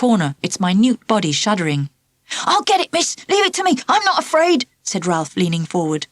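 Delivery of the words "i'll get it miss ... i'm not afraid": high eager schoolboy voice, keen to show off; the brave volunteer, pitched high; eager boy. Acted. Ralph to Miss Pickles, offering eagerly in a high boy's voice.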